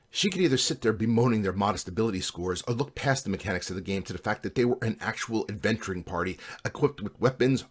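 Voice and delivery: ordinary delivery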